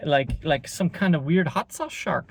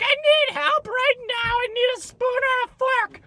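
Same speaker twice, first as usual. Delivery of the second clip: much higher, whiny put-on Karen; mock Karen voice. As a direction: lampoon the helpless customer in a high voice. Karen demanding help.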